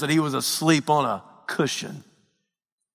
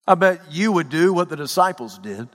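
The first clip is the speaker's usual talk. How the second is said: ordinary talk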